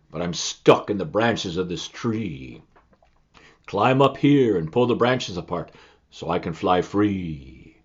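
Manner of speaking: low, slow booming wind; big wind voice. Acted. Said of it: "a big voice".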